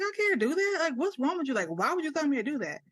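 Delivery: high, indignant disbelief; indignant protest. Retold or imitated. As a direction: protest like it's absurd. Protesting to trainer.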